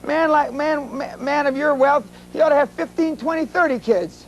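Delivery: high-pitched mock student; acted pleading kid. Kid argues back in retold exchange.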